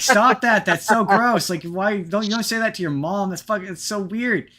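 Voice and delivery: a hammed-up horrified mother snapping at her daughter; mom scolding. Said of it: "And the mom's like".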